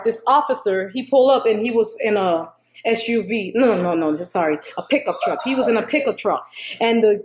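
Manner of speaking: ordinary delivery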